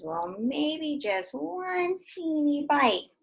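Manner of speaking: very slow, coaxing; tempted, slow. Acted. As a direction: stretch it out, tempted. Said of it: "He says".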